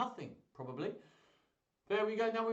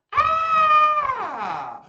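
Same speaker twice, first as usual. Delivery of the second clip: very high, loud song scream; long shrieked "Ah" dropping at the end; play scream; 17 dB louder than usual. Pretend. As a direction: shriek on cue in a children's song. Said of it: "don't forget to scream".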